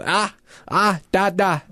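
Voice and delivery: imitating a babbling toddler; toddler babble. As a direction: babble like a baby. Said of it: "he turns around and he's".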